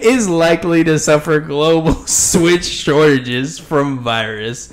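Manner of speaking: reading the headline through leftover laughter, slowed; still chuckling. Real, not acted. Host reads a news headline while still laughing at the joke, chuckling through it. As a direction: fight back giggles while reading. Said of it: "I'm still chuckling a little bit".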